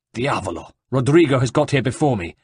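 plain reading voice

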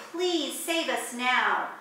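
ordinary delivery, nothing marked

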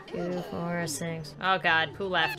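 ordinary talk, nothing marked

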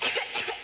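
two light throat-clearing coughs; small cough. Acted. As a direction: cough politely before speaking. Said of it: "it coughed once or twice".

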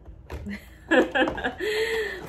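high laugh after a promise; rising, gleeful laugh. Real, not acted. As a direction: burst into high-pitched laughter.

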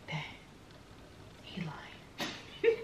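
hushed aside to yourself; whispered realization. Retold or imitated. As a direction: whisper the suspicion under your breath. Retold private thought about his lie.

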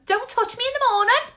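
high, very fast exclamation; rapid, high-pitched eureka; excited realisation. Real, not acted. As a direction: squeal out the answer. Realises she has it.

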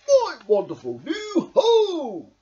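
high, loud, slow delight; overjoyed Badger; beaming Badger. A narrator's reading. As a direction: glow with joy at the house. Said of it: "beamed Badger".

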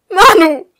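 high hysterical scream of a name. A narrator's reading. Woman calling the man backing away, screaming hysterically. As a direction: cry out at full force, high and hysterical. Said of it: "schrie sie auf".

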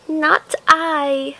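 high mewing cat refusing; a lazy cat saying no. A narrator's reading. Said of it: "said the cat".

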